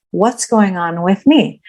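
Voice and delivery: ordinary talk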